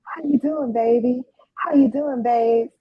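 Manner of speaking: effusive hello to a fan; greeting back. Acted. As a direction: greet each one with a flirty call. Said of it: "I say hello back".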